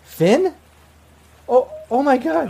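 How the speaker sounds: high, loud gasp of recognition; stunned ex-girlfriend. Acted. Woman spots the ex she thought dead, in high shock. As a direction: react as if seeing a ghost. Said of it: "ex-girlfriend that you thought was dead".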